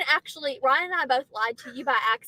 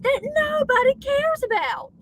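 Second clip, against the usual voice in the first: high, drawn-out exasperation; exasperated; 11 semitones higher, slower than usual. Real, not acted. Wife complaining about husband's online game, high exasperated whine. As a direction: stretch it out high in mocking exasperation.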